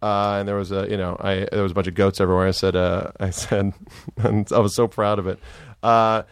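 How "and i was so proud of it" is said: ordinary delivery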